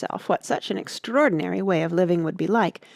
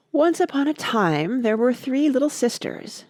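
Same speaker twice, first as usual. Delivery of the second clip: ordinary delivery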